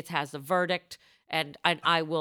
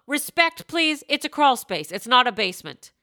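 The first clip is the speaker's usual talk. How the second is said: higher, louder mock-stern correction; mock indignation; 5 semitones higher, 7 dB louder than usual. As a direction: insist with pretend outrage. Mock-indignant correction of crawl space.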